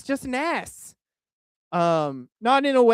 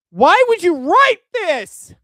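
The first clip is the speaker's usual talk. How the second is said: much higher, louder and slow, every word dragged out; exasperated yell; 12 semitones higher, 9 dB louder, slower than usual. Real, not acted. Streamer yells at a guest over an embarrassing tweet: slow disbelieving yell. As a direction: bellow it in total disbelief. Repeats question, now yelling.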